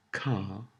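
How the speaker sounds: car with no R sounded. Shown as a demonstration. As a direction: say car as "cah", R dropped. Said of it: "is pronounced without the R".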